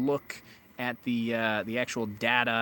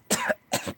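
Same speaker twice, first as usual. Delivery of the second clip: sharp coughing after inhaling a bug; choking cough; 5 dB louder than usual. Real, not acted. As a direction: cough hard, something in the throat. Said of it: "Ugh, I just inhaled a bug".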